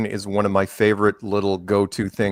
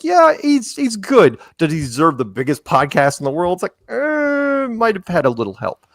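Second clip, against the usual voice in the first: sarcastic doubt; 8 semitones higher than usual. Retold or imitated. Sarcastic doubt about Rogan's success.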